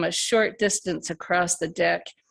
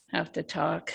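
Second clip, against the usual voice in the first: "Have to talk" dropped much softer, mulling the phrase over; quietly musing; 3 semitones lower, 7 dB quieter than usual. A narrator's reading. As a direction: let "Have to talk" fall away quietly, tasting the words. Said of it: "What a phrase".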